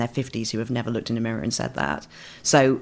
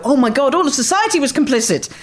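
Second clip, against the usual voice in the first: mock revelation; 12 semitones higher, 5 dB louder than usual. Mock realisation of viewer.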